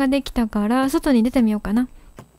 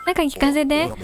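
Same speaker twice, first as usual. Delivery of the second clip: usual speaking voice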